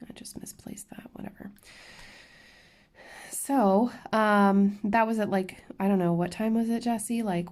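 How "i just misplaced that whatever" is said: unvoiced whisper, shrugging it off; soft whispered self-talk; whispered aside. Real, not acted. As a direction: mutter it under your breath as an aside. Whispered aside while crafting.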